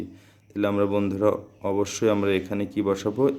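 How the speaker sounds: ordinary talk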